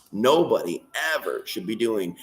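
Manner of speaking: ordinary talk, nothing marked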